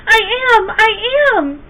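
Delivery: high, loud cry of I am; eager shout. A narrator's reading. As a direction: shout it out so they hear you. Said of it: "Sylvester wanted to shout".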